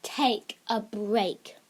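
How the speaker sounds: drawn-out emphasis on each word; slow and deliberate. Shown as a demonstration. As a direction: take a break, said very slowly. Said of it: "that trick is".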